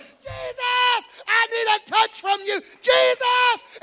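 high, loud desperate shout; Bartimaeus crying out. Acted. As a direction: call out above the people hushing him. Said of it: "but I went".

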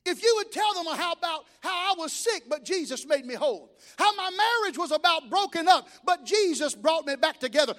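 preacher climbing to a triumphant shout; preaching at full force. Acted. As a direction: build the testimony to a roar. Preaching builds to climax.

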